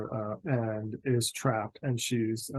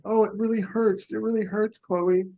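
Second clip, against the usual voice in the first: high, pained acted whimper; crying out in pain; 10 semitones higher, 4 dB louder than usual. Game master as injured Harriet, high cry of pain. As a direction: cry out to a friend, pitched well up. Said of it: "crying out".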